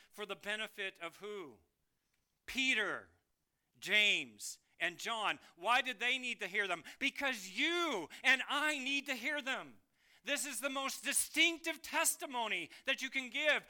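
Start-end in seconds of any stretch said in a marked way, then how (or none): 2.5-13.6 loud, rising, fervent preaching; full-force preaching. Real, not acted. Preacher urging the congregation to testify, loud and fervent. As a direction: drive the point home to the church.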